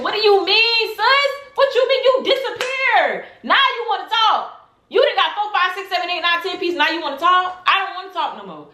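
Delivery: high-pitched, incredulous retold outburst; acting out a high, outraged challenge; indignant confrontation. Acted confrontation, says she's so mad.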